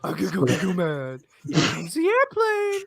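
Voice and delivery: put-on high, loud baby talk taunt; airplane spoon game. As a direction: coo at him like feeding a baby. Baby talk mocking Matt.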